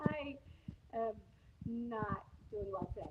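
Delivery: muffled, like through a wall. Real, not acted. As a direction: speak from behind a muffled mic. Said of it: "you sound muffled for some reason".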